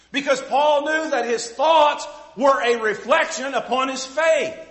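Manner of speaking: loud, slow, emphatic preaching; building sermon climax; full-force preaching. Acted. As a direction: drive each phrase home from the pulpit.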